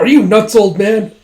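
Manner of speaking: loud, high-pitched disbelief; acted-out incredulous exclamation; retold indignant outburst. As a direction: exclaim it like a scandalized soldier.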